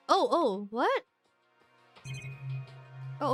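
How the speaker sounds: ordinary talk, nothing marked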